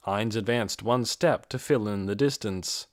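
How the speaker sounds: plain reading voice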